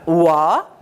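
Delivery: "oa" said alone as one wa sound; isolated syllable. Shown as a demonstration. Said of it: "Première syllabe".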